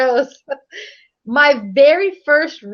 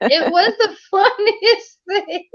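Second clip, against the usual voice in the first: slowed, breaking into a laugh on funniest; laughing through it; 7 semitones higher, slower than usual. Real, not acted. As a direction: laugh out the punchline. Laughing at her own story.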